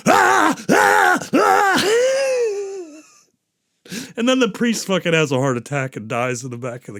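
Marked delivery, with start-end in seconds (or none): 0.1-3.0 hammed-up screams, last one drawn out; mock male scream. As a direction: imitate a man screaming in passion. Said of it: "he's screaming".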